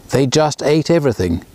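plain reading voice

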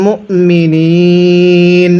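মুমিনিন with the last নি held long at the stop; three alif stretch at pause. Shown as a demonstration. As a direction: draw out নি in মুমিনিন for three counts. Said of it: "ওয়াকফের সময় তিন আলিফ টান দিব".

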